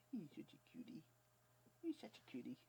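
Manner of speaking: soft, high cooing. Real, not acted. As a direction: coo tenderly over the puppy. Cooing over puppy.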